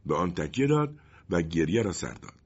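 ordinary delivery

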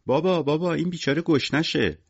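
put-on high, pleading child voice; high-pitched childish appeal; little girl piping up. A narrator's reading. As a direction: call to dad like a small child. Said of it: "صدای سونیا بلند شد".

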